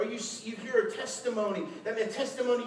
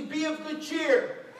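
loud, high, stretched-out proclamation; raised voice, drawn out; calling out; 8 semitones higher, 6 dB louder, slower than usual. Acted. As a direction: call it across to frightened friends, slowly. Acting Jesus calling to disciples.

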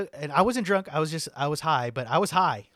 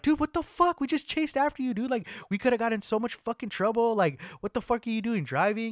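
high, louder scolding of a friend; retold angry confrontation; 8 semitones higher, 3 dB louder than usual. Friends confront the drunk driver who took off, angry scolding. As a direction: chew him out, pitched up.

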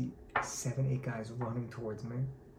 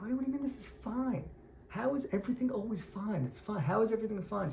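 fired up; 8 semitones higher than usual. Retold or imitated. Fiery rebbe challenges his ever-calm friend, exasperated. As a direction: imitate someone worked up at another's calm. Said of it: "he was, like, getting fired up".

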